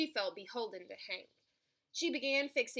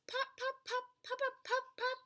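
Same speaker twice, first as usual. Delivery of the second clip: popping sounds; 13 semitones higher than usual. A narrator's reading. Corn popping from dragon breath.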